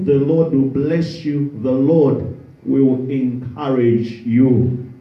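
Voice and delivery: normal talking voice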